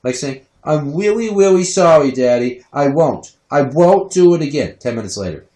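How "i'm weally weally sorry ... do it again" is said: put-on higher little-kid voice with W for R; babyish, insincere toddler apology; cutesy toddler. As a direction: apologise like a tiny child. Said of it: "cutesy way of saying W's in places of R's".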